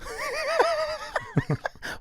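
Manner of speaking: high, breathless giggle; real high-pitched giggle; high squealing laugh. Real, not acted. Host cracks up.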